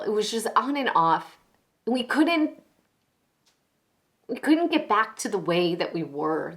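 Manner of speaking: loud, halting, troubled explanation; agitated woman pleading her case; distraught confessing woman. Acted. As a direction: explain yourself urgently, with broken-off pauses. Acted confession monologue.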